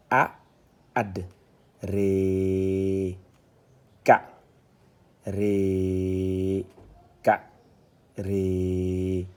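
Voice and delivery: isolated syllables with long steady vowels; detached reading. Shown as a demonstration. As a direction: recite syllable by syllable, pausing after each. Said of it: "on est en détaché".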